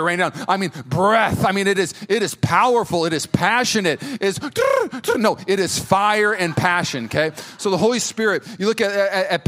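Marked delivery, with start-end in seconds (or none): 4.4-5.2 high, loud mock trill; quick rolled bird coo; dove cooing. Mocking the little dove idea, high trilled coo. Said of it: "it was not a little dove".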